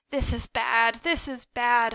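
usual speaking voice

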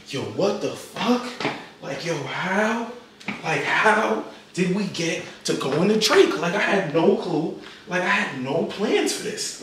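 hushed, slow, drawn-out amazement; retold hushed bewilderment; stunned disbelief. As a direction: marvel softly, stretching the key words. Pacing the house in disbelief.

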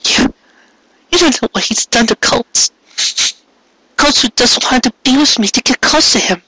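high, tearful cry. Acted. Cupcake crying to Carrot. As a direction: let the name break with tears. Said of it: "she cried again".

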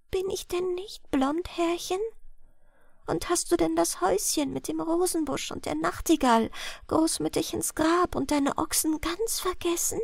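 put-on girl pleading softly that he remember her; frightened whisper. A narrator's reading. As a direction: whisper it in dismay. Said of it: "flüsterte".

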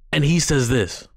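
usual speaking voice

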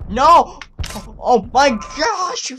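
high, loud cry of protest; exasperated yelling. Real, not acted. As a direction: yell it, drawing out the last words. Failing the game.

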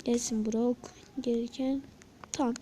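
ordinary talk, nothing marked

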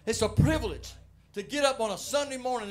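loud, high preaching voice; fiery preaching. Acted. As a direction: preach it out to the church with fire. Preaching at full force.

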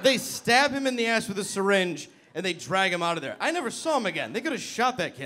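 ordinary talk

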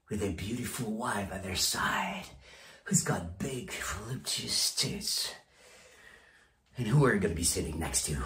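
low, soft, dangling the picture; hushed taunt. Acted. Boss paints a rival's success to staff, hushed taunt. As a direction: drop down low and quiet to tease them.

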